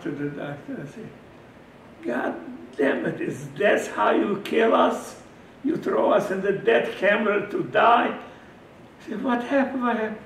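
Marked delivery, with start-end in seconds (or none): 2.0-8.2 loud, angry shout at the doctor; furious shout. Retold or imitated. 9.2-10.2 startled. Retold or imitated. Doctor startled.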